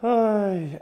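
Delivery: imitated sigh, voiced and pushed out; demonstrated sigh. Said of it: "and he sighs, and go".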